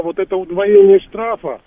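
ordinary delivery

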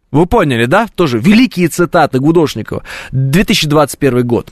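usual speaking voice

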